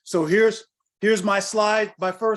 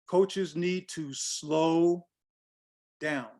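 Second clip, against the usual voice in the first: slowed way down; much slower than usual. Shown as a demonstration. Urges coaches to slow down.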